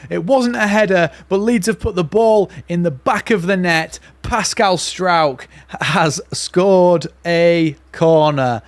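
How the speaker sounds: screaming high and loud with joy, stretching the words; goal celebration. Real, not acted. Fan screaming at the stream as Leeds score from a corner. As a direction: roar it out as the ball hits the net.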